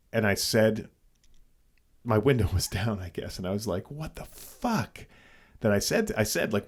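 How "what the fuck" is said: high, slow, soft retold shock; shocked disbelief. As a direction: stretch it out in mock disbelief. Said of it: "it was more of a shock".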